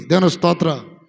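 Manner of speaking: shouted praise to God; ringing Praise the Lord; loud praise. Acted. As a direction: call out praise to the church. Praise exclamation.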